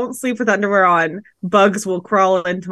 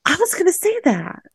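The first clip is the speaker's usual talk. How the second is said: sultry late-night radio voice; sexy radio voice; 8 semitones higher than usual. Retold or imitated. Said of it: "That was like the sexiest you've ever sounded".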